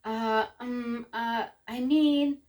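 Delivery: slow, drawn-out hemming and hawing; hesitant stammering. A narrator's reading. Father caught out by Tia's question, stammering hesitantly. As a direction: stall for words, dragging each filler.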